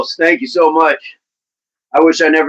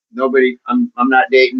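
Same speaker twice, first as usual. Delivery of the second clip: normal talking voice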